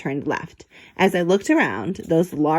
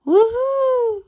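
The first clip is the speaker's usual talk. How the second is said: high, loud eerie hoot; spooky high hooting call; creepy yell; 4 dB louder than usual. Acted. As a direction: yell it like a ghost from the dark. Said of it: "I heard a creepy voice yell".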